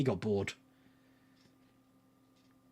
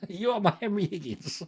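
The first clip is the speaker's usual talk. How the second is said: amused, words breaking into laughter; laughing; 3 semitones higher, 4 dB quieter than usual. Real, not acted. Teasing Phil as elocution teacher, laughing as he speaks. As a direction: laugh through the line.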